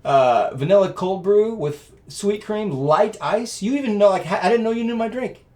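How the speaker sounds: high, loud, amazed listing of the drink; wife stunned and thrilled; wife amazed he knew her exact order; delighted surprise. Retold or imitated. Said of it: "she goes".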